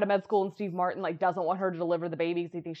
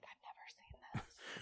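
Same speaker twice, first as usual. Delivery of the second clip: slow, barely voiced whisper; tiny retold whisper; whispered admission; 22 dB quieter, slower than usual. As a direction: mouth it almost silently, as a secret. Retold quiet admission about film.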